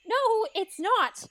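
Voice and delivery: high-pitched, climbing incredulity; indignant disbelief. Acted. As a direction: protest in mounting disbelief, pitch soaring. Indignant at the wrong slipper.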